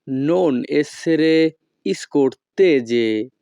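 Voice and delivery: each Italian word spaced out very slowly; slow teaching model. Shown as a demonstration. Teacher models Italian phrase for students, very slow. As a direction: spell it out slowly for learners to copy. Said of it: "তখন আমাদেরকে বলতে হবে".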